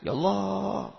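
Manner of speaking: lightly acted prayer plea; pleading prayer. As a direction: plead upward, stretching the name. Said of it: "Maksudnya saya minta itu".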